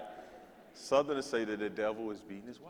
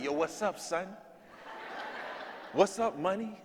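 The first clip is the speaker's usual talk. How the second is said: lightly put-on high New York greeting; mock New York slang; 6 semitones higher, slower than usual. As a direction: mimic classmates back from the North. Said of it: "they like".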